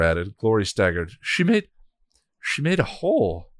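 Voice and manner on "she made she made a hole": shocked. Acted. Dazed god staggers.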